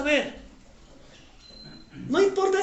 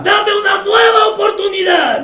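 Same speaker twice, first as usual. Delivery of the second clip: much louder, calling out each line; shouted prayer lead; 6 semitones higher, 13 dB louder than usual. Real, not acted. Pastor leads the standing congregation line by line, shouting. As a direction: shout the prayer for the church to echo.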